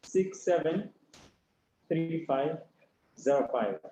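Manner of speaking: slow repeat of a phone number; measured digit-by-digit readout with pauses; slowly. Real, not acted. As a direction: dictate the digits in small, separated groups. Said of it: "I'll say it slowly".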